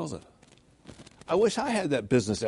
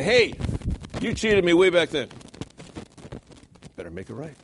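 higher, louder confronting cry; accusing call; 3 semitones higher, 4 dB louder than usual. Retold or imitated. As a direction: call someone out for cheating. Voicing an accuser at church.

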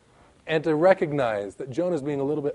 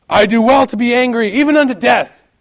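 higher, louder, defiant Jonah voice; Jonah answering angrily; 7 semitones higher, 8 dB louder than usual. Acted. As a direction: fling the answer back at God in anger. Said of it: "And he says".

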